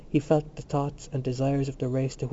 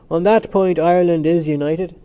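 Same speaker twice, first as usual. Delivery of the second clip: louder, higher firm declaration; 4 semitones higher, 7 dB louder than usual. A narrator's reading. Glynn speaks for all Ireland, in a firm tone. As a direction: declare it firmly for everyone. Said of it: "said in a firm tone".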